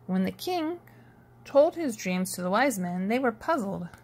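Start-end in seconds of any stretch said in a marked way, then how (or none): none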